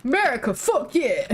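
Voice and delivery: hammed-up American accent, shouting like a patriot; mock American accent yelled for laughs; American cheer. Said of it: "the need to put on a deep voice when I do it".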